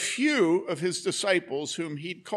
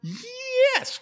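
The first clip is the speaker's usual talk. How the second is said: excited yes; 5 dB louder than usual. Real, not acted. Child gives right answer.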